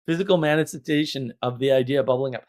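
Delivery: normal talking voice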